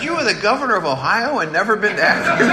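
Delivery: high, disbelieving question with a laugh; can't believe what he's hearing; incredulous stranger. Retold or imitated. Stranger doubting the governor never visited Akron, laughing and high.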